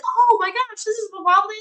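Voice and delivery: high, delighted recognition; thrilled to hear it; excited. Retold or imitated. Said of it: "And you're like".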